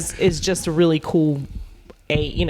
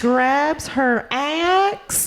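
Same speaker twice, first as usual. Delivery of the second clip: slow, higher Southern drawl; 5 semitones higher, slower than usual. Pretend. Player describes her slow Southern character, slow drawl. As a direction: stretch each word sweetly. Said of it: "slow and cautious".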